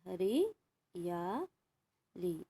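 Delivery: हरियाली spoken very slowly, every syllable drawn out; teacher stretching हरियाली out for dictation; slow model word. Shown as a demonstration. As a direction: spell out हरियाली slowly for pupils. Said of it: "इस तरह से लिखेंगे".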